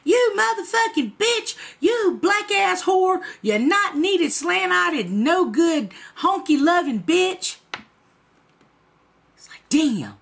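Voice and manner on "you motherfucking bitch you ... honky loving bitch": high, loud imitated tirade of insults; retold cursing rant at a raised pitch; angry cussing out. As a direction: act out his furious letter, hurling each insult. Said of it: "that motherfucker was cussing me out".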